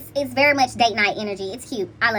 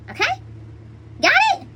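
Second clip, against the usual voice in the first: much higher, upbeat check-in; high bright check-in. Real, not acted. Reader making sure viewers understood, bright check-in. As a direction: pop the question up brightly.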